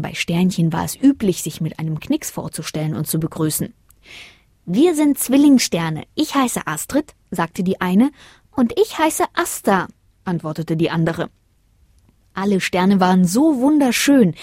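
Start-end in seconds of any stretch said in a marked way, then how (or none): 4.7-7.1 high, slowish small voice; little twin star. A narrator's reading. Twin star curtsies to Lina, sweet introduction. 8.5-9.9 high little voice; second twin star. A narrator's reading. Other twin adds her name, high-voiced introduction.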